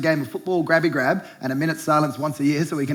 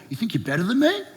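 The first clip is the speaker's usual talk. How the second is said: mock bogan jab; 6 semitones higher than usual. Anthem parody ending in jab.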